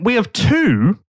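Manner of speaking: ordinary delivery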